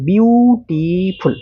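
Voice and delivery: বিউটিফুল slowly stretched over three separate beats; broken into syllables. Shown as a demonstration. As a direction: pull বিউটিফুল apart, বিউ... টি... ফুল. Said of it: "কয়েকবার সিলেবেলে ভাঙছো".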